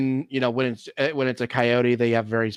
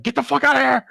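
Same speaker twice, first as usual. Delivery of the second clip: yelling at coyotes; 12 semitones higher, faster than usual. Retold or imitated. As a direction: chase the coyotes off with a yell. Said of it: "I'm like".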